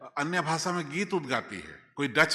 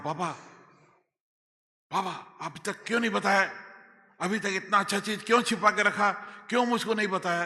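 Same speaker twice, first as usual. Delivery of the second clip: put-on higher, pleading child to father; loving complaint; 8 semitones higher, 4 dB louder than usual. Son lovingly quarrels with God the Father, pleading. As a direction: lovingly scold your father. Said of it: "प्यार से लड़ता है".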